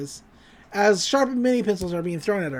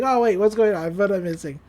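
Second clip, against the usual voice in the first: normal talking voice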